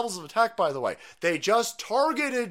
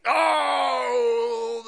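loud, high, drawn-out "oh" of triumph; triumfalny okrzyk; 6 semitones higher, 5 dB louder than usual. Real, not acted. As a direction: cry out long and high in delight. Delight at finding the article.

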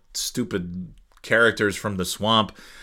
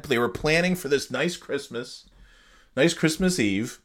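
ordinary talk, nothing marked

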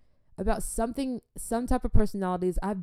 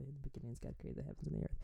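soft, half-voiced recital; muttering to herself; 6 semitones lower, 16 dB quieter, much faster than usual. Real, not acted. Trying to remember the verse, muttered softly. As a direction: mutter the verse under your breath. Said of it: "That is easy, actually".

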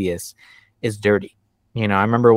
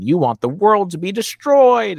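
pitched-up, exaggerated accusation; mock alarm; 9 semitones higher, 4 dB louder than usual. Voicing what believers think atheists want, mock alarm. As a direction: rise dramatically as if naming a catastrophe.

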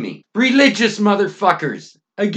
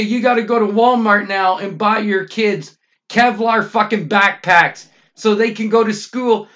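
normal talking voice

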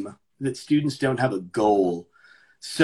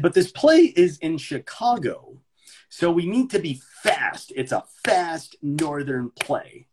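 slow, emphatic, higher-pitched mock instruction; condescending director; 4 semitones higher, slower than usual. Director lecturing Houston cast, patronising. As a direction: spell it out slowly as if to slow actors.

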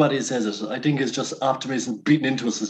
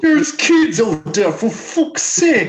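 irate caller; 12 semitones higher, 4 dB louder, slower than usual. Pretend. Caller complaining to radio show.

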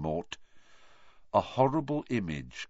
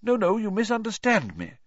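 smiling; 13 semitones higher, 3 dB louder than usual. A narrator's reading. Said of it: "now smiling".

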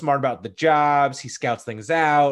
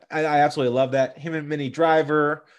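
ordinary talk, nothing marked

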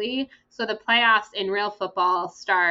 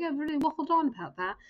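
a hint of a British accent, higher; light British accent; 6 semitones higher, 4 dB quieter than usual. Quoting how British people say it, in a light British accent. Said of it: "It sounds cuter in their accent".

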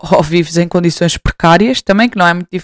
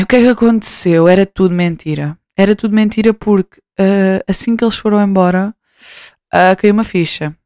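normal talking voice

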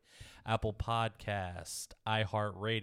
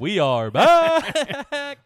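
very loud, high, drawn out; big show-intro shout; 14 semitones higher, 14 dB louder, much slower than usual. Real, not acted. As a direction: belt it out and hold the last word.

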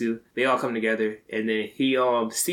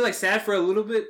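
ordinary delivery

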